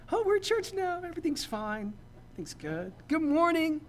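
high, falsely sweet "all is fine" voice; fake cheerful churchgoer. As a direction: pretend nothing happened, sing-song and bright. Mock cheerful church-face after arguing.

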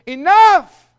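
huge high shout. Retold or imitated. Missionary answering the student's question, a huge high shout. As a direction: blast it out at the top of your voice. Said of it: "missionary Fisher says".

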